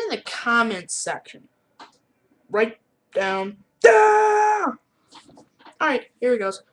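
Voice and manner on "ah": musical shout. Pretend. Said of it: "here's some musicals".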